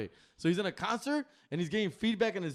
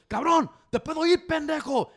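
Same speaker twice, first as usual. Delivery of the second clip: higher and louder furious yell; angry singer cursing; 8 semitones higher, 6 dB louder than usual. Retold or imitated. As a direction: snap at the engineer in a rage. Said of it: "He goes".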